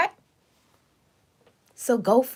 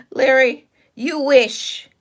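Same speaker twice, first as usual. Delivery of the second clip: laughing; 6 semitones higher, 4 dB louder, slower than usual. Real, not acted. Mocking a rude chatter named Larry.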